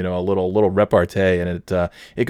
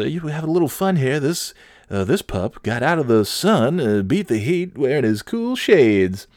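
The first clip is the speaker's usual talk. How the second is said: mock news anchor; 6 semitones higher than usual.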